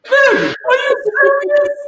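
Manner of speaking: shocked disbelief. Retold or imitated. Comic shocked at security friend.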